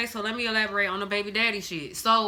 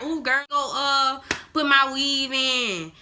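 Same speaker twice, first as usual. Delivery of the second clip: drawn-out, higher-pitched mock sassy caricature; 4 semitones higher, slower than usual. Shown as a demonstration.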